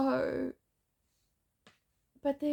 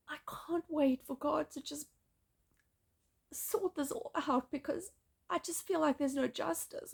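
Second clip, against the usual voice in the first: ordinary talk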